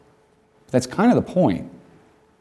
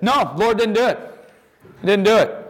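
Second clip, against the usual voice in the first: put-on risen boy's denial; 9 semitones higher than usual. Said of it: "he rose and said".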